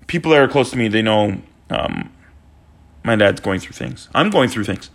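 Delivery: low, sombre aside; subdued, personal. Real, not acted. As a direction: drop low and confide it heavily. Sombre aside about his dad.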